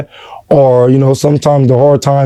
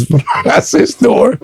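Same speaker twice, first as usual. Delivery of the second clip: laughing through the words, higher; a real laugh breaking in mid-line; 11 semitones higher than usual. Real, not acted. Remembering the dollar store toys, laughing.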